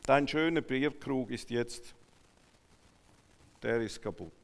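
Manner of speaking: usual speaking voice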